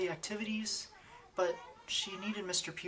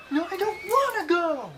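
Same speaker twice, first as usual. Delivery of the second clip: put-on very high, loud protesting kid; little kid refusing to go home; whiny child; 14 semitones higher, 10 dB louder than usual.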